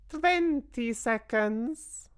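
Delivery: high, slow witch voice; a gloating witch counting down; witch's cry. A narrator's reading. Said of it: "cried the Grand High Witch".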